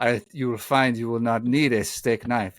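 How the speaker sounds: imitated French waiter's accent; quintessential French accent. Waiter reassures young diner about the steak, French accent. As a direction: play the French waiter, accent on every word. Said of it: "quintessential French accent".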